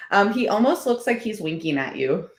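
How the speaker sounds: ordinary delivery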